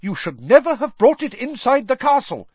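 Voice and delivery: high, loud angry yell. Acted. McGonagall yelling at Fudge about the Dementor. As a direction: yell it at the Minister. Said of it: "yelled Professor McGonagall".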